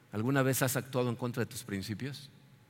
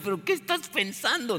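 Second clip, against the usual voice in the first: higher, disbelieving question; incredulous sailors; 8 semitones higher than usual. Acted. Sailors to Jonah, in higher disbelief. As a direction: ask what on earth he was thinking. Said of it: "los marineros le van a decir".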